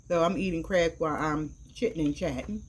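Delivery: normal talking voice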